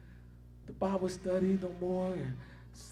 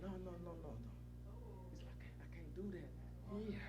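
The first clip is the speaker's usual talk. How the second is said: much softer, murmured no, no, no; hushed refusal; 16 dB quieter, much faster than usual. Retold or imitated. Preacher retelling the brother's refusal to smoke, hushed and soft. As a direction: say it like a quiet private refusal.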